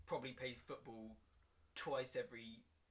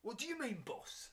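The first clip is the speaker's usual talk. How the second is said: 1 cockney; 12 semitones higher than usual. Acted. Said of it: "Kyle is a bit cockney".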